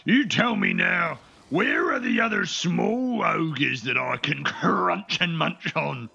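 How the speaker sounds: slow-witted ogre. Acted. Ogre interrogating a captured small ogre, slow menacing voice. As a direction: labour slowly through it, long pauses between phrases. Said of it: "six neurons firing desperately, and he goes".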